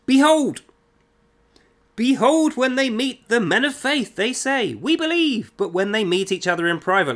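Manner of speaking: high, loud, declaiming read of the verse; scripture reading. Acted. Critic reading the last Quran verse aloud, declaiming. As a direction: proclaim the verse like scripture from a pulpit.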